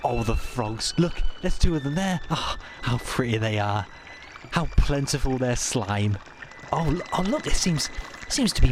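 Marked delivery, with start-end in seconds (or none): none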